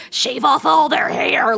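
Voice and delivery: loud, slow, mocking parent command; mock parent order. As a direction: bark it out like a bossy parent. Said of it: "your parent is just like".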